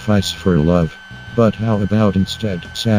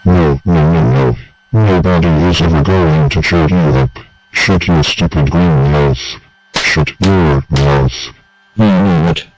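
loud, lowered furious tone; angry refusal; 3 semitones lower, 4 dB louder than usual. Acted. Angry friend refuses to cheer the other up, loud threats. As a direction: shut them down hard and loud.

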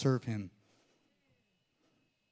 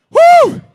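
much higher, much louder whooping slap sound; loud whoosh of a slap; 20 dB louder than usual. Shown as a demonstration. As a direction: let out a sharp whoop for the slap. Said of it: "take the open palm of your hand".

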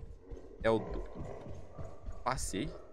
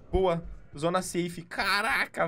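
ordinary talk

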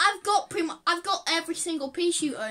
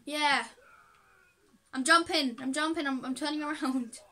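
ordinary delivery

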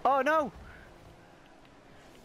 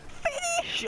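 a much higher yell urging the finish; squeaky urging shout. Real, not acted. Gamer shouting at his own shot to finish it. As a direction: push the ball in with your voice.

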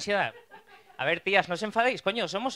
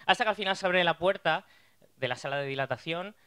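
normal talking voice

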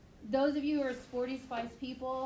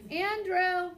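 higher, louder call; calling name; 8 semitones higher, 9 dB louder than usual. Retold or imitated. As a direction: call out to the next room, insisting. Mom calling louder again.

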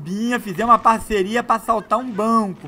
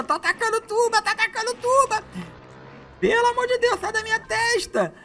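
much higher squeaky mock panic. Gamer pestered by a mosquito mid-gameplay, hammed-up high squeal. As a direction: squeal as if the bug were a monster. Mosquito attacking his face.

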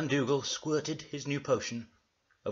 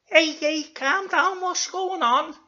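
loud, very high falsetto; hammed-up high-pitched alarm; high character voice; 10 dB louder, slower than usual. Zebedee arrives at the commotion.

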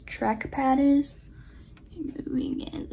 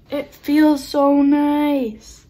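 louder, slow, gushing with delight; 2 semitones higher, 12 dB louder, much slower than usual. Real, not acted. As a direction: shout it out with joy, slowly. Repeats it loudly, delighted.

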